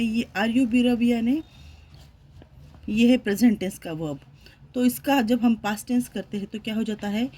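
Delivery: ordinary talk, nothing marked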